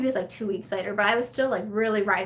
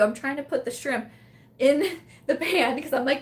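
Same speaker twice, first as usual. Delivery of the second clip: usual speaking voice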